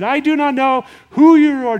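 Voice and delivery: sharp, high, loud denial; angry denial. Acted. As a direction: throw the denial back at the accuser. Peter denies knowing Jesus, acted.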